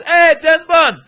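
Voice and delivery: very high, very loud whoop of recognition; delighted shout. Retold or imitated. Second officer turning round to see traveler, delighted shout. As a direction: yell it like a starstruck fan. Acting colleague's bigger exclamation.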